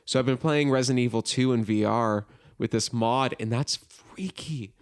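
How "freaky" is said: hushed emphasis. Real, not acted. Describing the scary VR mod.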